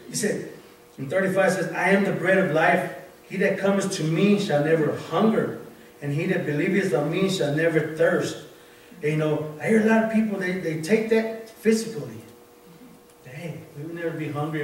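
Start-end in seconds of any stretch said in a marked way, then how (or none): 1.7-8.4 louder, declaiming Bible verse; scripture reading. Acted. Reading John to the congregation, louder proclaiming tone.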